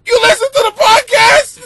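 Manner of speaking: high, loud, laughing boss imitation. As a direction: play the boss cracking up mid-sentence. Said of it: "He starts laughing at me".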